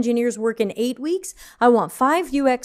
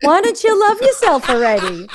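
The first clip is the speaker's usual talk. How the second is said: higher, louder New York nagging; condescending New York accent; 6 semitones higher, 5 dB louder than usual. Shown as a demonstration. Exasperated self-help narrator scolding the listener, loud New York accent. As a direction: badger them in hammed-up New Yorkese. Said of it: "in a New York accent".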